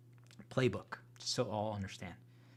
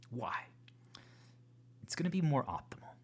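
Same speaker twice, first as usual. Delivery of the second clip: ordinary talk, nothing marked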